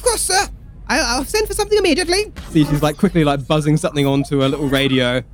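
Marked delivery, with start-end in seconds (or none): 0.0-2.3 high, hurried subordinate voice; private's voice. Acted. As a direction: squeak out a quick yes sir.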